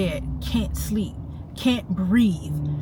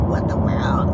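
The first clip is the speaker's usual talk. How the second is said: whispered disbelief; slower than usual. Real, not acted. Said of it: "unvoiced whisper while driving".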